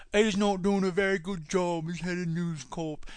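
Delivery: put-on soft, over-the-top British accent; mock British accent. Second take in a fuller British accent. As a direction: redo it as a proper Briton. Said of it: "my flawless British accent".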